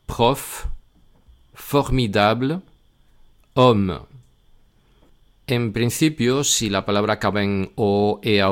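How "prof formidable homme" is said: prof, formidable, homme said in French with an open O. Shown as a demonstration. Teacher reads open-O French words to learners, careful French. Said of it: "Y con una O normal".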